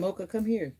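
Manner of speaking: calling the dog. Real, not acted. Owner calling her dog Mocha to come over. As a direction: call the dog over sweetly but loudly.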